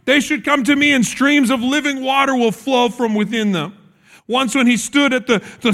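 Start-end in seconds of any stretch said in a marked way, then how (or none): 0.1-3.7 higher-pitched preacher's proclamation, mild volume; quoting a public cry, raised in pitch; loud voice. A narrator's reading. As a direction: call it out to a crowd, pitched up. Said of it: "he said in a loud voice".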